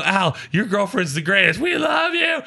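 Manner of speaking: high shouted praise; retold gleeful cheering. Drunk friend praising Al's girlfriend, gleeful shout. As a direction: cheer it out, rising to a squeal.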